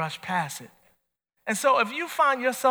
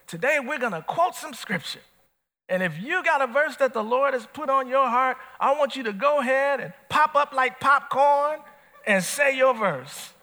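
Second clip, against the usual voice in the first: raised, higher, hammed-up church announcer; church leader voice; 6 semitones higher than usual. Preacher imitating the church leader inviting verses, lightly hammed. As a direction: pump up the room like a small-church leader.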